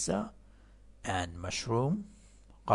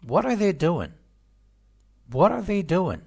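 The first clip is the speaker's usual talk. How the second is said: loud, high "What", falling away on "doing"; falling intonation; 8 semitones higher, 8 dB louder, slower than usual. Shown as a demonstration.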